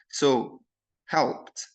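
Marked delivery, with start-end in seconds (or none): 1.1-1.7 "helped" ending in a crisp voiceless T; final ED as T. Shown as a demonstration. Teacher showing final ED after P, "helped" with T ending. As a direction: say "helped" as one syllable, "helpt". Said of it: "ال final E D هنا نطقناها T sound".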